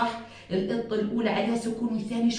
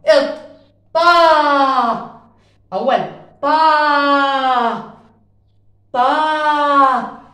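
loud, drawn-out vowels; loud stretched drill; stretching the vowels; 5 semitones higher, 7 dB louder, much slower than usual. Shown as a demonstration. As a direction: stretch each syllable loudly for the class. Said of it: "أنا قاعد بمد".

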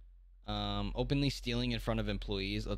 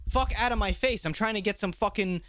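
higher, snapping at the prankster; mock annoyed shopper; 7 semitones higher than usual. Shopper snapping at the prank YouTuber, mock angry. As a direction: play the fed-up shopper brushing him off.

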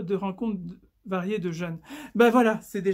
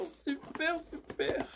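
much softer, whispered "super, super"; whispered repeat; 7 semitones higher, 9 dB quieter, much slower than usual. Real, not acted. Vlogger savouring her find, fading whispered repeat. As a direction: let the word fade to a breath.

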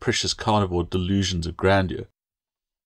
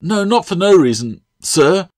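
protesting; 10 semitones higher, 6 dB louder than usual. Acted. Girl protests to master.

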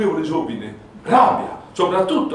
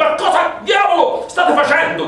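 high angry shout, trembling; shaking with anger; 10 semitones higher, 4 dB louder than usual. Acted. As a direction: yell it, trembling with rage and shock. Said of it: "con voce tremante di rabbia e di sorpresa".

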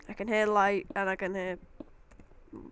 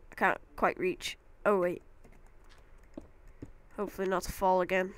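normal talking voice

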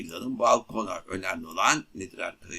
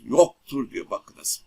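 "Yoktur" said slow, louder and higher; stress on yoktur; 4 semitones higher, 5 dB louder, slower than usual. Shown as a demonstration. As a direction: lean on "Yoktur" slowly. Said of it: "çok önemsiyoruz". ("Yoktur" kelimesini yavaş ve vurgulu söyle)

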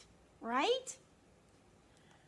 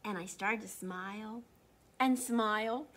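put-on lively read-aloud for kids; animated storybook narration; story reading; 8 dB louder than usual. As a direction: read it like a picture book to children. Reads a picture book aloud.

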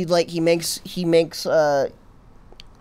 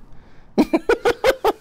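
high burst of laughter; big laugh. Real, not acted. Laughing at friend's remark.

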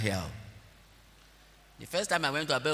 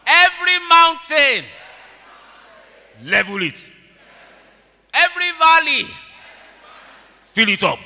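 high, loud shouting prayer; fervent shouted prayer; 6 semitones higher, 11 dB louder than usual. Real, not acted. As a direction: cry it out to heaven.

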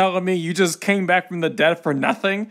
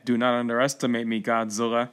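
lower, softer and quick menace; menacing villain; 7 semitones lower, 5 dB quieter than usual. Acted. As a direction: threaten him coolly like a movie villain.

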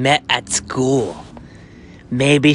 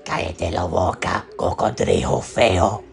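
lower, growled Spanish; deep Spanish insult; 7 semitones lower than usual. Acted. Chipoko insulting the waiter in Spanish in a deep voice. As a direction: snarl it in Spanish.

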